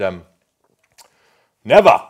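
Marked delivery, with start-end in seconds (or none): 1.6-2.0 loud, high, defiant; Churchill's defiant never. Retold or imitated. Preacher imitating Churchill's defiant never. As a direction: bark out one word like a wartime leader. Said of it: "he got up and he said".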